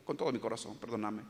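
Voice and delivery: low, soft, heartfelt apology; low and soft, a sincere sorry; sincere plea. Retold or imitated. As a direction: beg forgiveness gently from the heart. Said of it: "le dice".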